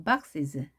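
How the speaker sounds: "boxes" pitched low with a weak "es"; weak falling ending. Shown as a demonstration. Teacher models "boxes" for learners, weak ending dropping down. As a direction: drop the "es" of "boxes" down lightly. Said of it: "is 발음을 약하게 해서 내려주시고요".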